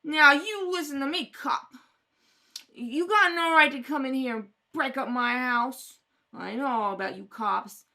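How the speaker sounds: loud, jabbing defiance at the cop; angry warning to a cop; defiant anger. Acted. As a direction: put him in his place. Mother defies the cop.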